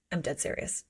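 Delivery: hushed, low mock-solemn reply; understated, quiet earnestness; deadly serious. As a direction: drop to a low, soft murmur. Acting out his earnest reply.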